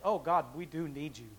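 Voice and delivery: usual speaking voice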